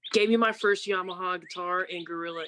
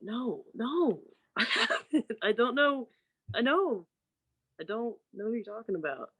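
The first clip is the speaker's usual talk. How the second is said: higher-pitched protest with a laugh; playfully exasperated, pitched up; mock exasperation; 5 semitones higher than usual. Sister fending off brother's repeated question, exasperated.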